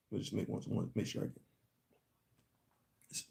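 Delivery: very quiet, low aside; talking to oneself. Real, not acted. Host checking the notes to make sure, hushed low aside. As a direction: drop right down as if thinking aloud.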